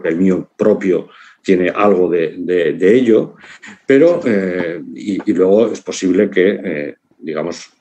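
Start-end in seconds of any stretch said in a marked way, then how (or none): none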